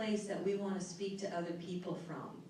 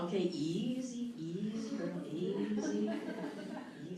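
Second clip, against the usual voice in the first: calming yourself. Retold or imitated. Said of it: "you're going".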